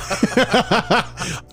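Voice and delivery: high burst of laughter; laughing hard. Real, not acted. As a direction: break into helpless laughter. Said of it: "right there, I lost it".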